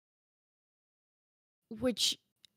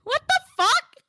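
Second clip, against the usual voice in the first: high, loud yelp of disbelief; loud, high-pitched "what on earth" reaction; shocked outburst; 8 dB louder than usual. Real, not acted. Startled outburst at chat.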